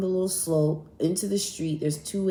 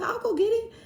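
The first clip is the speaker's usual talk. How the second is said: chirpy high-pitched quick offer; high eager offer. Retold or imitated. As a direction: jump way up high and bright-eyed for the offer. Own retold offer to friend.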